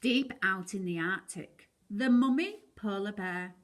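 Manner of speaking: ordinary delivery, nothing marked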